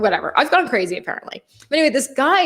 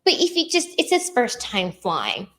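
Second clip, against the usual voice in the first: lightly imitated pleading dad, slowing down; a touch of mock whining protest; 3 dB quieter than usual. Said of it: "He goes".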